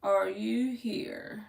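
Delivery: slow, soft, half-whispered question; hushed searching. Real, not acted. Reader looking for Daisha in the chat, hushed searching. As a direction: draw it out under your breath. Checking whether Daisha is present.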